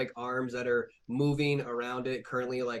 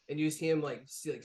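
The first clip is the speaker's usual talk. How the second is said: ordinary delivery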